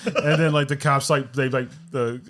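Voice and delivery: usual speaking voice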